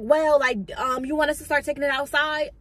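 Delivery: high, loud, impatient voice; mock pushy boss. Boss rushing her to move her stuff out, loud and high.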